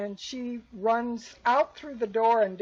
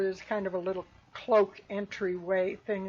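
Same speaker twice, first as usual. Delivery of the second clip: normal talking voice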